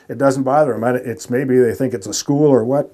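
ordinary talk

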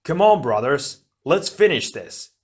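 loud rallying cry; rally your brothers over the fight; shouted. A narrator's reading. Tahu rallies his brothers to finish the fight, shouted. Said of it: "Tahu shouted".

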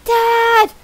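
high, loud yell for Dad; son's cry. Acted. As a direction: shriek for your father as a kid. Son calls out to dad.

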